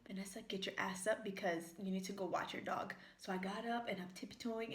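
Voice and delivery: telling how she crept up on the dog, hushed. Retold or imitated. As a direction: keep it quiet, sneaking. Sneaking up on the dog.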